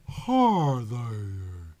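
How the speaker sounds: a light Southern drawl greeting. A narrator's reading. Southern woman greeting the detective in a drawl. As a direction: drawl it Southern, slow and easy. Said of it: "in her thick southern drawl".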